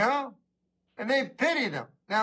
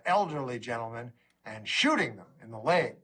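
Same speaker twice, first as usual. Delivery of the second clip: ordinary talk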